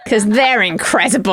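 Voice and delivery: ordinary talk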